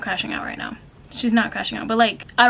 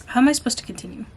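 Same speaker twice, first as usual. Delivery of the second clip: normal talking voice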